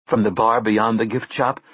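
usual speaking voice

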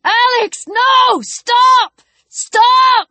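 high, desperate shouted plea; screamed. A narrator's reading. Chris begs Alex to stop from down the trail, screaming. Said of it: "Chris screamed".

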